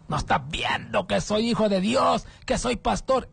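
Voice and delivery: high mock yell at the policeman; retold pastor yelling. Pastor yelling at the policeman about being God's son. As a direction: shout it like an offended pastor. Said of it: "le empezó a gritar al policía".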